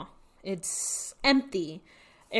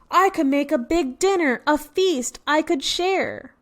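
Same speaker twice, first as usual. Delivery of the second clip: bear voice; 6 semitones higher, 4 dB louder than usual. Acted. Bear's line voiced in picture book.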